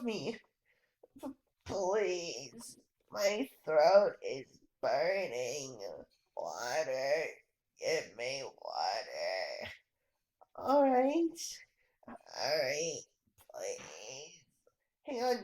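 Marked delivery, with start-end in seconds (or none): 1.2-9.7 low, hoarse dying croak; a much lower, hoarse and faint dying rasp; dying man's plea. Acted. Burned man begs, throat burning. 12.1-14.4 low, breathless dying whisper; a voice fading to a whispered please. Acted. Dying man answers, fading.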